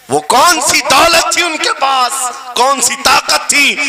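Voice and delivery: high, ringing rhetorical questions; impassioned oratory; fiery preacher. Real, not acted. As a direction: throw the questions out to the crowd.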